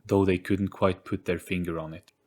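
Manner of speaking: ordinary delivery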